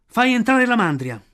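loud, high rallying call; shouted command. Acted. Mowgli orders Akela to drive the herd in, shouting. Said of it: "incitati dalle grida di Akela".

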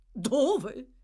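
un accenno di Bumble alticcio; voce di Bumble. Acted. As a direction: una domanda alta, divertita. Bumble, tipsy from gin. (Bumble reso faceto dal gin, voce più alta)

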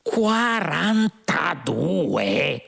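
high, very slow incredulous howl; outraged yell. A narrator's reading. Lunqual repeats the computer's answer, yelling in outrage. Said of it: "urlò Lunqual".

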